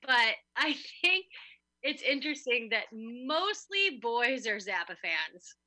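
ordinary talk

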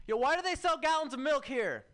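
high, strained street-character voice; homeless guy impression. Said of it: "my impression of a different homeless guy".

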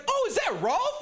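high, rising surprised call; light up recognizing a friend; excited. Retold or imitated.

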